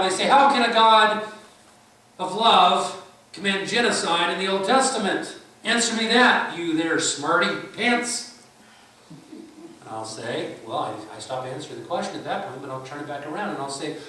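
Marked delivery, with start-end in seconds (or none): 0.2-8.4 loud, high taunting challenge, slowing on the last words; mocking skeptic. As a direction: sneer at the preacher like a smug heckler. Skeptic voiced challenging the preacher.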